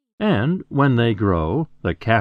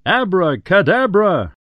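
high, loud magic word; shouted spell; 9 semitones higher, 5 dB louder than usual. Acted. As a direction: cast the spell with a big shout.

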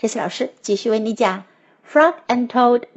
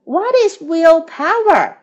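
puzzled question; 5 semitones higher, 5 dB louder than usual. A narrator's reading. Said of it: "asked Toad".

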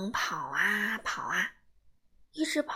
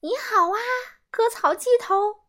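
high, loud jeering greeting; mock him with a singsong hello; taunting kid; 12 semitones higher, 7 dB louder than usual. A narrator's reading. Kids taunting Simon. (孩子们起哄嘲笑，尖声高喊)

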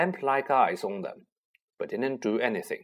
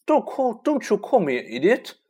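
indignant; 9 semitones higher, 4 dB louder than usual. Acted. Neville retorts to insult.

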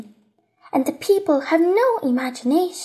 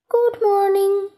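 higher, sustained sing-song greeting; roses' voice; 4 semitones higher than usual. A narrator's reading. Roses answer the little prince, high lilting greeting. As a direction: lift it up and hold it level, as talking flowers. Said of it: "said the roses".

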